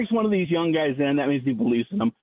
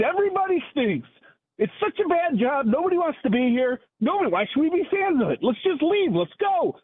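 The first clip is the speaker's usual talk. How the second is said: mock-despairing tirade pitched much higher; sarcastic caller rant, voice climbing higher and higher; 7 semitones higher than usual.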